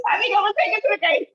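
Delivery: high, loud and fast, laughing through the words. Real, not acted. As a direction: repeat it while still cracking up. Repeats the tease while laughing.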